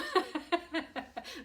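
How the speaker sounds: high amused laugh. Real, not acted. Laughing at her own mock scolding.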